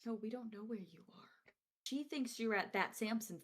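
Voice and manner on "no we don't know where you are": whispering. Acted. Secretive reply about aunt.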